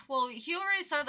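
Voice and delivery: usual speaking voice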